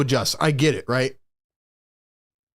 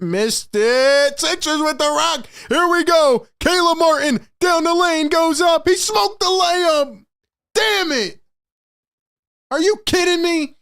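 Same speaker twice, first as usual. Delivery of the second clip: excited yelling; 11 semitones higher, slower than usual. Real, not acted. Fan streamer yelling at the game for his chat, excited. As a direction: yell at the screen as the play unfolds.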